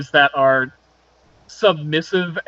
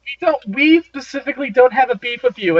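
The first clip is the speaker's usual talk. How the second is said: higher, lighter voice for the vampire woman; vampire girlfriend voice; 8 semitones higher than usual. Acted. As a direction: play her with a lifted pitch.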